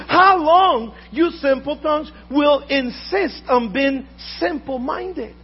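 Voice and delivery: loud, fervent pulpit preaching; booming sermon voice; full-force preaching. Acted. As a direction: preach it out to the congregation with fire.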